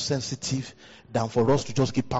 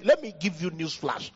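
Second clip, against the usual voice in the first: ordinary talk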